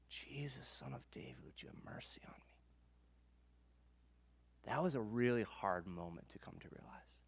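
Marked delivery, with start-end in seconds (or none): 0.1-2.6 a hushed, breathless whisper begging for mercy; whispered plea. Retold or imitated. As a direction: whisper it like a beggar pleading from the roadside. Blind man calling from roadside.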